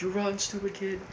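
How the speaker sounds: mock angry dad. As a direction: imitate a dad's yell without the volume. Angry dad scaring off kids.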